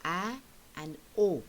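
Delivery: "a and o" with each vowel held apart, slowly; isolated vowel sounds. Shown as a demonstration. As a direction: pick out the vowels in "a and o". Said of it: "So you have".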